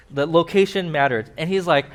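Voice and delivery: ordinary talk, nothing marked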